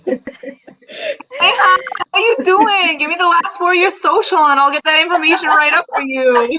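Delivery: hammed-up New York accent, tickled. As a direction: play a New York call-center lady. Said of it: "you got a New York accent".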